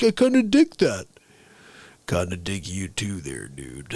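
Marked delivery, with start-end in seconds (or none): 2.1-3.9 low, soft cowboy reply; low and easy, like the old cowboy; the Stranger's drawl. Retold or imitated. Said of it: "That's freaking everybody from the movie".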